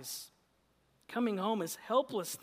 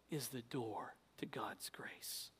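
soft whispered refrain; 9 semitones lower, 13 dB quieter than usual. Acted. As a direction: whisper it to them.

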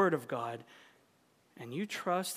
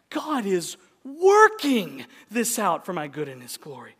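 loud line, weight on working; stress on working; 8 dB louder than usual. Shown as a demonstration. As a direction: lean on the word working. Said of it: "emphasize different words in that sentence".

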